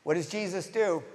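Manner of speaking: ordinary talk, nothing marked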